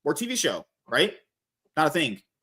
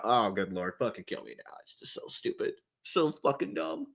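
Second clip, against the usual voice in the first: despairing groan; 8 semitones lower, 9 dB quieter than usual. Real, not acted.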